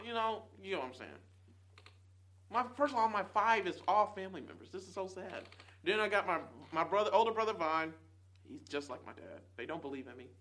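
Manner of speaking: ordinary talk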